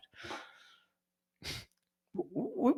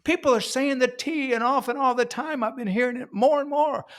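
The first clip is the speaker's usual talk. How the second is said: normal talking voice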